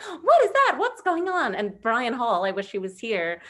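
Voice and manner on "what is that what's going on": high, loud mock alarm; mock alarmed ad-lib. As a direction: play the startled crowd on stage. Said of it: "all ad-libbing, like".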